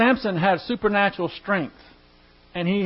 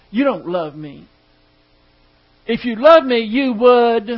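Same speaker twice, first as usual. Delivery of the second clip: mock wife; 3 semitones higher, 7 dB louder than usual. Husband hears wife's complaint.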